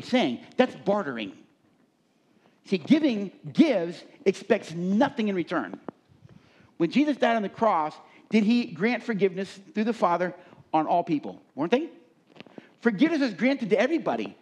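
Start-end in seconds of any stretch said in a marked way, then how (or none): 2.6-14.4 high, loud preacher's climax; rising pulpit fervor; fiery preaching. Acted. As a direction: preach it out with full force.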